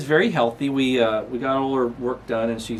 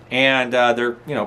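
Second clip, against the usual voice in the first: ordinary delivery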